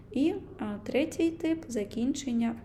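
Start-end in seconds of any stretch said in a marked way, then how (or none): none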